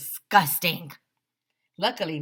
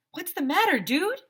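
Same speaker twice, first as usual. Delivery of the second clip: put-on boyish concern; 12 semitones higher, 4 dB louder than usual. Boy voice, Ryan concerned.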